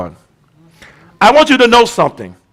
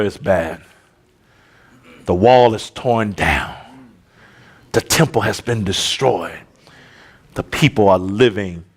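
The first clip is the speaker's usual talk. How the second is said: much softer, lower Bible-story telling; hushed storytelling; 10 semitones lower, 3 dB quieter than usual. Acted. Pastor quietly retelling Nehemiah to the church, hushed. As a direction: draw them in quietly with the story.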